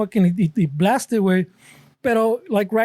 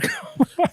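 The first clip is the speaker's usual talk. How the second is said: high burst of laughter. Real, not acted.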